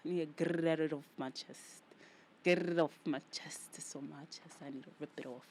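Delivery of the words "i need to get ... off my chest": louder, pushed repeat; emphatic. Shown as a demonstration. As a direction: push the words out harder. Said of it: "repeats line louder".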